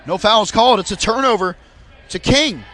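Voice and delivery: brisk live sports call; play-by-play commentary. Acted. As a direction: call the game as it happens.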